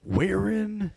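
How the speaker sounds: hammy wrestler promo, swooping pitch; Randy Savage. Acted. Eulogising a dead wrestler in his own style, theatrical impersonation. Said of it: "[name] doing the amazing, amazing impersonations tonight".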